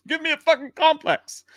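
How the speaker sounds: very high-pitched indignant complaint; mock exasperation. Host blaming viewers for his confusion, mock exasperation. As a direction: wail it high, playing wounded.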